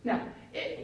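ordinary talk

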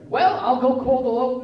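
hammed-up helpful parent; overeager rescuing parent. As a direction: jump in loudly with a solution. Acts parent jumping in to fix.

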